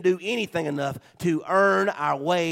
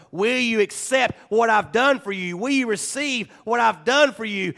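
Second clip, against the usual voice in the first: louder, higher fervent appeal; altar call; 7 semitones higher, 7 dB louder than usual. Acted. Pastor inviting listeners to accept grace, fervent plea. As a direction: plead with them to receive it.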